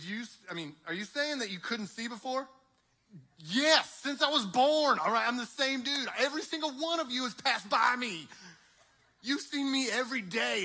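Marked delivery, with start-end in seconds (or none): 3.4-10.6 higher, loud frustrated shouting with a laugh; exasperated shout. Acted. Blind man yelling at people who passed him by, exasperated shout. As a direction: yell it at the crowd who ignored him.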